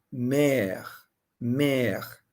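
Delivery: mère with open è vowel, falling tune. Shown as a demonstration. Teacher shows students how mère sounds, open vowel. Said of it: "É um I aberto".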